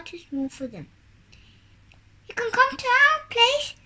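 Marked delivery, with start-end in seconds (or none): 2.3-3.7 high, loud call; eager little rabbit crying out. A narrator's reading. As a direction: call out the invitation in a raised, high voice. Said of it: "cried the little rabbit".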